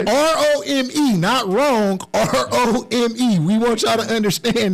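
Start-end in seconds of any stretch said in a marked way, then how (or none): 0.0-3.4 R O M E spelled slowly, each letter separate. Shown as a demonstration. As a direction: spell out R O M E deliberately. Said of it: "Why you just won't say it right?".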